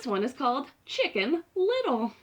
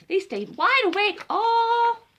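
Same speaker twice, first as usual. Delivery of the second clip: high, loud, slow dramatic telling; dramatic read; 10 semitones higher, 7 dB louder, slower than usual. Acted. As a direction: build it up big. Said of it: "Chicken reads dramatically".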